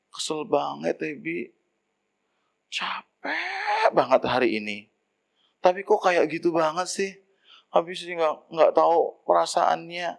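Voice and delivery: soft, weary wife's complaint, partly whispered; tired complaint. Acted. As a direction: complain softly like a worn-out wife. Wife complains tiredly to husband. (keluhan istri yang capek, pelan dan setengah berbisik)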